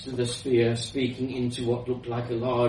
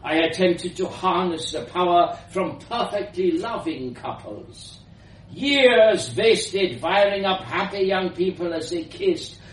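put-on high, emphatic old scientist with a foreign accent; elderly foreign woman; 5 semitones higher, 6 dB louder than usual. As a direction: rant about wasted years, ending loud. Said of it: "she continued".

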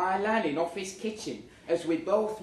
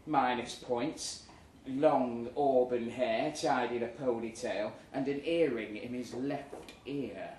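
usual speaking voice

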